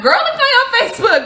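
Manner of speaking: high fast teasing; gleeful gossip; teasing friend. Retold or imitated. Friend teasing about a Facebook post, high fast teasing.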